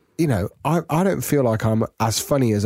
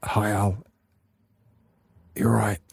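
subdued, lightly acted poorly caller; sick employee caller; 3 semitones lower, 4 dB quieter, slower than usual. Employee rings his boss in role reversal, subdued poorly voice. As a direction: open the sick call feebly. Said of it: "role reversal".

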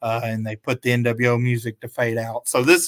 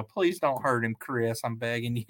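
ordinary delivery

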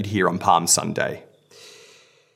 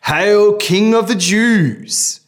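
mock hail; 11 semitones higher, 6 dB louder, much slower than usual.

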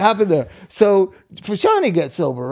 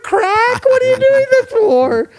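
much higher, louder hammed-up panic; mock alarmed Gwen; 6 dB louder than usual. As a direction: squeal it like a shocked do-gooder. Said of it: "it felt like she was saying".

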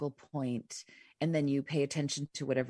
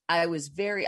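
ordinary talk

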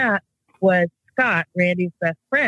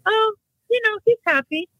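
high mock surprise; 14 semitones higher than usual. Retelling her child's ghost talk, high and quick. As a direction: voice jumps way up, quick and light. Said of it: "I'm like".

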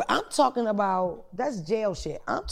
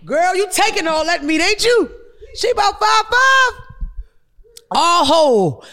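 loud, high hyped yelling; whooping at the tape, higher and louder; excited shout; 9 semitones higher, 6 dB louder than usual. Real, not acted. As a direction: shout it out in disbelief. Hyped reaction to sex tape.

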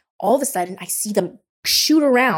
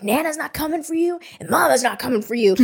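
raised, stern mock yell at a toddler; imitating dad yelling; 7 semitones higher than usual. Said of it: "he's yelling at her".

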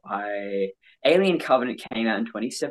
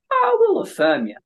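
mocking affirming voice; 6 dB louder than usual. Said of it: "What do people do?".

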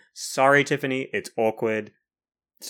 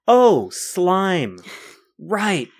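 higher, louder, grossed-out recoil; mock disgust; 5 semitones higher, 6 dB louder, much slower than usual. Co-host reliving wading into slimy Lake Ontario, mock disgust. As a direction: act out touching slime underfoot.

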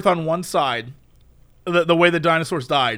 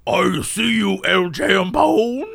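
slow, shouted boss line with the voice cracking; much slower than usual. Retold or imitated. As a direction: try for a gruff boss and crack on El Champone. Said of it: "I kept saying it like that".

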